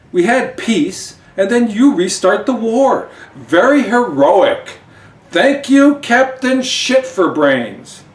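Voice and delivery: high, loud outraged shouting; furious yell. Acted. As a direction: scream at him for wrecking the peace. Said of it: "yells at him".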